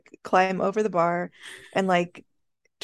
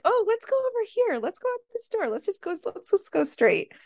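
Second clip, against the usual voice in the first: very high, softened squeak, herding a friend along; high coaxing voice; 12 semitones higher than usual. Retold or imitated. Pulling angry friend out the door, high voice. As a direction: coax them along way up high. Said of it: "in that high voice".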